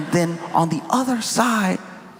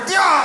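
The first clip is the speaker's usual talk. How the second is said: battle cry; 12 semitones higher, 4 dB louder than usual. Retold or imitated. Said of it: "he over here".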